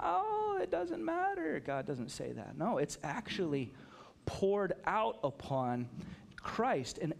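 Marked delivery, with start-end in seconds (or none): 0.0-1.6 much higher, airy brush-off; breezy dismissal. Retold or imitated. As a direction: toss it off like it's nothing. Mock of careless dismissal.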